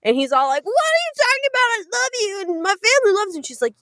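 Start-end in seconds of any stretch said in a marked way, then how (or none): 0.7-3.4 much higher, fast, hammed-up protest; mock upset fiancé. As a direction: rattle it off like a wounded fiancé.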